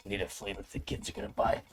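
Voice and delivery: put-on decrepit old boss. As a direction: croak it like a frail rich old man. Old rich man demanding flavor.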